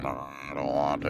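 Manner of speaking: low, soft groggy grumble. Retold or imitated. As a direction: say it half asleep. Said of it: "tired and groggy".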